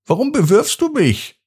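high, mildly cross question; a bit annoyed. A narrator's reading. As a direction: sound a little cross. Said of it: "die schon ein bisschen sauer ist".

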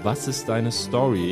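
ordinary delivery

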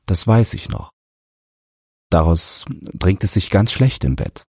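halting, lightly voiced apologetic father; remorseful father. A narrator's reading. Father confesses broken cup to son. (zögernder Vater, leise Reue)

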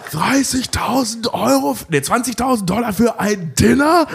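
high slow appalled question; outraged disbelief. Retold or imitated. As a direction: gasp at the dinner bill. Outraged accountant quote.

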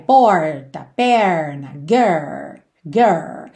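put-on low "Porta", "Perna", "Girl" with tongue curled back on R; curled countryside-style R. Shown as a demonstration. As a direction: linger on the R, leaving "Girl" without its L. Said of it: "Pensa nesse R".

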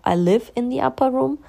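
uplifted English affirmation. Shown as a demonstration. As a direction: lift the voice for the upper room. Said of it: "Oder manche sagen auch".

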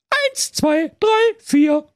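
very high piping count; little goats counting off; kids counting. A narrator's reading.

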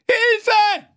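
shrill, high cry for help; panic scream. Acted. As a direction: scream as loud as possible. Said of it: "schrie, so laut er nur konnte". (panischer Hilfeschrei)

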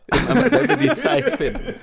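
high, laughing put-on voice; squeaky mock voice. Mocking reply to outsider question.

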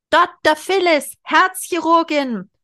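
high, loud shout from the crowd; calling out loud. Acted. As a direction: call it out slowly across the room. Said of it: "jemand rief laut".

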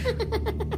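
put-on high, quiet old woman's giggle; soft giggle. A narrator's reading. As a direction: giggle softly into the blanket. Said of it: "kicherte nur leise in ihre Bettdecke".

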